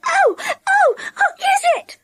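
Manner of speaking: very high, squealing alarm; startled cry. A narrator's reading. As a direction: squeal in fright. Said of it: "she cried".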